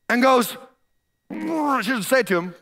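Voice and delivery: ordinary delivery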